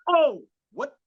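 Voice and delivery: high-pitched mock confusion; imitating bewildered audience; baffled reaction. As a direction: squeak out a puzzled crowd's response.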